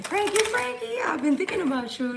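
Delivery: high, loud sing-song nickname; excited call-out. Real, not acted. Streamer hailing a viewer by nickname, high and loud. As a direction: sing out the name with glee.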